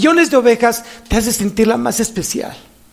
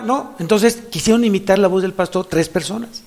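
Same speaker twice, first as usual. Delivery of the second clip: normal talking voice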